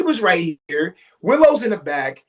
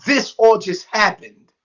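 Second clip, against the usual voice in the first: ordinary delivery, nothing marked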